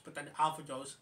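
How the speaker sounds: ordinary talk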